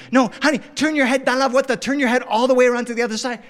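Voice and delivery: high, hurried parental instructions; dad rushing to get a child to look; rattled and speeding up; frantic coaxing. Acted. Dad urgently directs daughter.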